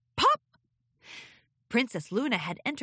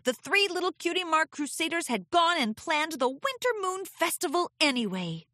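ordinary delivery

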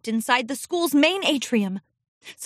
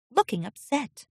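ordinary delivery

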